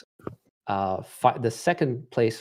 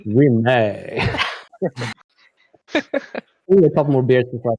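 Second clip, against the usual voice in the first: Western accent; 3 dB louder than usual. Shown as a demonstration. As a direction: have a go at an American Western accent. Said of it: "Say it with your best Western accent".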